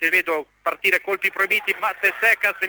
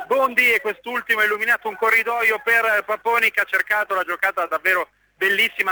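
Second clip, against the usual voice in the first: usual speaking voice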